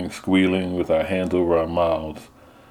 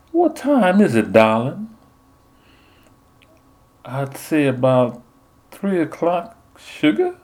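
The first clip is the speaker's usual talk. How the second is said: high sugary belle voice; sweet southern belle; 8 semitones higher than usual. Retold or imitated. Nun as Portia sweet-talks the Soothsayer in a belle voice. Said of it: "silky, sweet voice".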